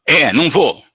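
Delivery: angry spirit. Acted. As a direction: snap it back, still furious. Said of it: "Está bravo ainda? Está".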